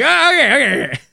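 high, loud, backing away; mock nervous teacher. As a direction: placate someone scary. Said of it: "He's like".

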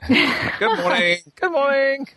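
very high, drawn-out cartoonish fish greeting; The Meaning of Life fish. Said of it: "sound like the, uh, fish".

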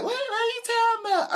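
very high squeaky woman voice; high falsetto what; confused girlfriend. Retold or imitated. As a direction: play her baffled.

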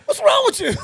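high, disbelieving shouting; high laughing disbelief; baffled yell. Retold or imitated. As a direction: yell at the screaming neighbor. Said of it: "I said".

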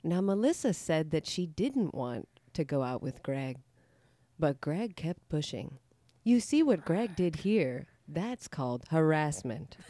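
slow, low, soothing corporate narration; calm voiceover for a harassment training video; training video narrator. Shown as a demonstration. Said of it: "Can you do a sex, a sexual harassment one for us".

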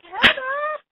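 high, loud drawn-out squeal; squealed name. Pretend. Two friends shrieking each other's names, high squeal. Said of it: "I'm gonna be like".

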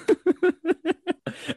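squealing laugh; high cackle of laughter. Real, not acted.

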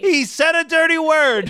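high-pitched mock outburst; comic quoted outrage. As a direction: squeal it out like a movie quote. Quoting the film's yelled line.

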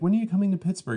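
high, whiny fan question; mock nagging fan. Comedian imitating a late Pittsburgh fan, high and whiny. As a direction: nag him like a fan who missed the show.

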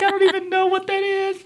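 very high, helpless mock protest; retold bafflement. Newcomer admitting ignorance of nonprofit paperwork, squeakily baffled. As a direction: plead ignorance with pitch way up.

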